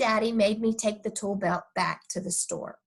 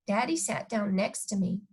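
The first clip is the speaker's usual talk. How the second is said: ordinary delivery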